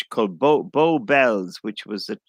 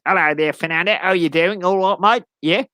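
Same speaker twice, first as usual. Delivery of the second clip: higher, chirpy Cockney cabbie greeting; Cockney accent; 7 semitones higher, 7 dB louder than usual. Acted. London taxi driver greeting a passenger, higher-pitched Cockney accent. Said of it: "with a Cockney accent".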